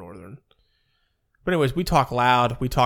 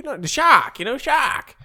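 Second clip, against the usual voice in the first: put-on Boston accent; 10 semitones higher, 8 dB louder than usual. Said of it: "like a Boston accent".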